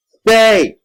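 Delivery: loud, very high screamed order. A narrator's reading. Monster comes after the fleeing child, screaming. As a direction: scream the command. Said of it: "he screamed".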